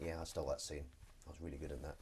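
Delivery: low, soft imagined actor's aside; self-satisfied aside. Retold or imitated. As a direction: murmur it low, as if privately to yourself. Acting out a smug actor's thought.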